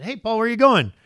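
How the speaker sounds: high, loud eager hail; calling out. Acted. Bystanders asking Paul where he is going, calling out. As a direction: call out to him brightly from a distance.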